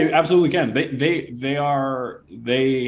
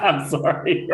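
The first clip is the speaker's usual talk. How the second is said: helpless real laughing apology; 3 semitones higher than usual. Real, not acted. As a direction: apologise through a burst of laughter. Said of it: "I was laughing at Gabriel's".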